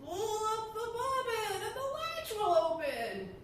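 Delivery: slow, high, put-on gentle voice; the wolf sweetening his tone to fool her; a slow, high, falsely sweet granny voice; wolf softening his voice. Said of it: "softening his voice as much as he could".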